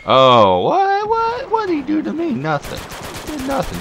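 very high, loud incredulous "What?"; outraged disbelief. Real, not acted. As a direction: squeal in disbelief at the kill. Outrage at being killed.

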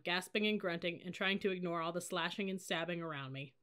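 ordinary delivery, nothing marked

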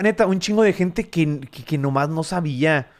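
ordinary talk, nothing marked

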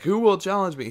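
high, loud challenge to the crowd; calling out a challenge. Retold or imitated. Mimicking the preacher daring the campus crowd, a high loud call. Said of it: "trying to call people out".

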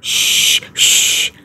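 whispered shh, then gentle comfort; calm the crying child like a mother; soothing mother. Acted. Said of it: "Sabi ni Mama".

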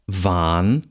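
"Wahn" with a drawn-out a; long vowel. Shown as a demonstration. Lecturer reads minimal pairs, long vowel. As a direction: hold the a in "Wahn".

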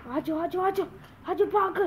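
normal talking voice

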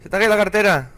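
loud, high-pitched call. Pretend. Player telling someone of a dropped wallet, high calling out.

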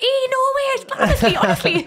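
high, loud Geordie outburst; broad Northern. Retold or imitated. Imitating herself on the phone to her mum, broad Northern accent. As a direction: go suddenly very Northern, pitched right up. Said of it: "It goes so northern".

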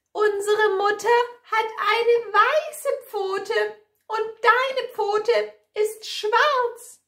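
very high, quick little-goat voices; defiant kids. A narrator's reading. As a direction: cry out in a high, insistent child's voice. Kids refuse the wolf again.

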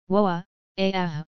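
startled, fearful exclamations; a frightened woa, trailing off; shocked and fearful. Acted. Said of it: "shocked and fearful voices".

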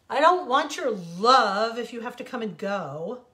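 loud, pained rejection; bitter refusal. A narrator's reading. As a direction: push their love away. Sand refuses the flowers' love.

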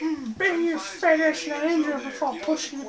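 high-pitched put-on creature voice; Yoda. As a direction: play a little cartoon sage with hummed mm's. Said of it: "People say I sound like Yoda".

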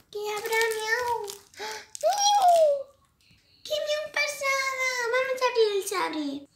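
high squeaky kitty voice sprinkled with meows; cat voice. Acted. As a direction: talk like an excited kitten, meowing. Unboxing the cat toy, meowing.

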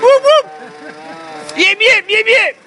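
high yapping little dog; yappy chihuahua voice. Pretend. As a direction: yip like a tiny excited dog.

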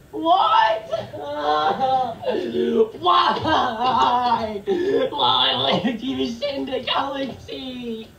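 long, whimpering cries of disbelief; anguished wail. Real, not acted. Streamer who just got a galaxy gift, wailing in despair. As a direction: wail it out, half crying, half laughing.

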